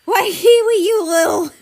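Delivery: loud, high angry outburst, cut off; huffing anger. A narrator's reading. Australia furious at Kiwi before being cut off. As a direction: snap at her, out of breath.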